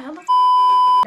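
high, loud squealed outburst; mock shout. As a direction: shriek it out in disbelief. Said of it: "'Cause I was like".

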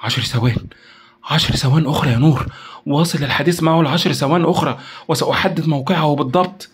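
tense, mildly frantic urging; urgent plea. A narrator's reading. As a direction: beg a colleague to stall for time. Pleading to stall a traced call.

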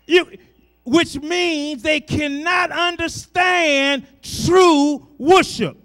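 loud, rising preacher's cry; full-voiced gospel preaching; preaching at full force. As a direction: preach it out to the congregation. Preacher climax.

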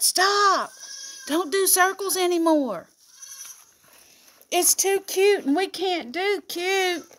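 high, squeaky pretend kid voice; voicing the baby goats. Goat owner voicing her baby goats for the camera, high and squeaky. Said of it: "They decided".

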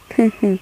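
short teasing chuckle; mocking laugh. A narrator's reading. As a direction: laugh at a flustered friend. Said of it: "ว่าเสียงล้อผมเต็มๆ".

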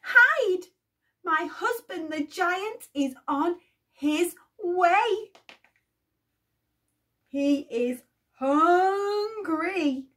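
high, loud fearful urging; frightened warning. Acted. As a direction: hurry him into hiding, scared. Giantess warning Jack in panic.

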